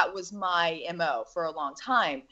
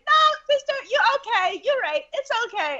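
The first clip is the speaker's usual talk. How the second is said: put-on much higher, gentle little-girl voice; little girls soothing. The girls keep reassuring her, high soothing little-girl voice. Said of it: "And they're like".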